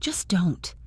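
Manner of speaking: unvoiced, broken whisper; whispering through heartbreak. A narrator's reading. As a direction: barely get it out. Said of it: "her voice broke".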